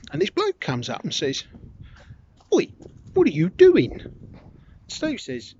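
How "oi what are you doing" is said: challenging shout. Retold or imitated. Stranger challenges the bus driver.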